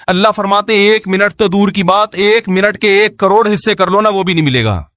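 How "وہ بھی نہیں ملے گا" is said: ordinary talk, nothing marked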